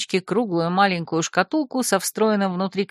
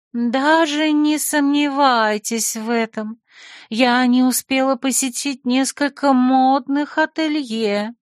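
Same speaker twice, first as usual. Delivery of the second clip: slow, higher, languid and flirty; 5 semitones higher, slower than usual. A narrator's reading. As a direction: purr it out like a coquette. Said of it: "томно произнесла женщина". (томно, кокетливо)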